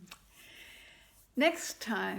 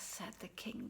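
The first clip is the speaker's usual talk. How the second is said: whisper; 4 semitones lower, 12 dB quieter than usual. A narrator's reading. Narrator tag whispered.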